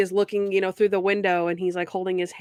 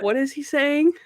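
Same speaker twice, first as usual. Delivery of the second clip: higher-pitched puzzled question; mock bafflement; 6 semitones higher than usual. Puzzled listener asking what the hobo said, mock baffled. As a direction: imitate a baffled listener, voice lifting.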